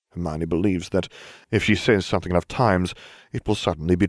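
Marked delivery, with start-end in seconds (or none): none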